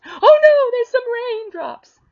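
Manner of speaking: squealing, high, crying mock alarm, then slow; hammed-up whimpering fright; mock panic. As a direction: mimic a flimsy church shrieking at a few raindrops. Mocking the weak church's panic.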